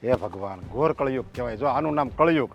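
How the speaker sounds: low, soft, heavy-hearted wail to God; despairing lament to God. Acted. Father, hurt that his son defends the wife, lamenting quietly to God. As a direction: groan it out as if the world is ending.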